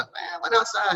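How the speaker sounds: imitating daughter. Dad mimicking his daughter's excuse, high and breezy. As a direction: toss it off like a breezy kid. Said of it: "What are you doing?".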